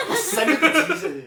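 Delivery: high, loud, laughing through it; cracking up while repeating the line. Retold or imitated. Repeating the quoted threat, laughing.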